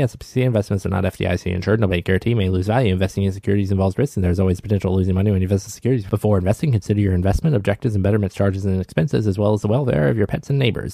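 rapid, low-pitched fine-print voice; legal disclaimer read. Acted. Host reading Betterment's legal notice after the show, rapid disclaimer. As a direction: rattle it off like small print at an ad's end.

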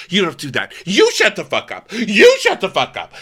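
loud, high imitation of a scolding; mock fury. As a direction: build to a hammed-up indignant yell. Acting out Vicky's angry outburst.

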